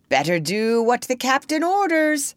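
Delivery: high, uneasy pirate voice; nervous. A narrator's reading. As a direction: advise a crewmate uneasily. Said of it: "said Starkey nervously".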